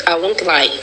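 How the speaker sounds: ordinary talk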